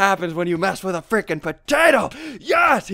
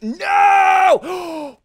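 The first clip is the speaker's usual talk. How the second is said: long, loud high cry; drawn-out high yell; panicked scream; 10 semitones higher, 6 dB louder than usual. Real, not acted. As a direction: wail it out in dismay. Nearly falls in game.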